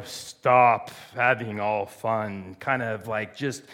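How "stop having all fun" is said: low, slow, grumbling scold; mock a killjoy father; stern disappointed God. Preacher imitating a frowning distant God, low and slow. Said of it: "we see God as, like, this furrowed brow".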